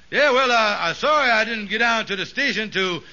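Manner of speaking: ordinary delivery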